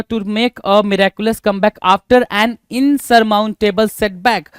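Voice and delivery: very slow, each word spaced; slow dictation. Shown as a demonstration. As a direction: read it out for students to copy. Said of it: "dictates definition slowly". (धीरे-धीरे लिखवाना)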